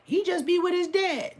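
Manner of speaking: high, innocent parent voice; high innocent reply; high mock parent. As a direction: answer sweetly and high like the parent. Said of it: "asked the parents".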